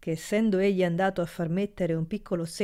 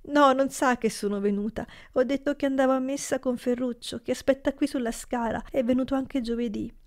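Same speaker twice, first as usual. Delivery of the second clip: put-on higher, anxious pleading girl; pleading niece; 6 semitones higher than usual. A narrator's reading. Niece explains to her uncle, pleading.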